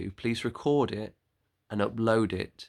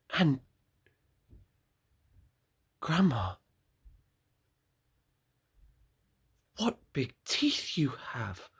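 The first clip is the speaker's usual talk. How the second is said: 1 fearful whisper; 4 semitones higher than usual. Acted. Girl fearful at teeth.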